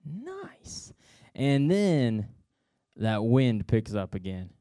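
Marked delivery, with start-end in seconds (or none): 0.0-0.9 high, soft and slow in wonder; awed Peter. Acted. Peter strolling on water toward Jesus, hushed awe. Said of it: "he's like".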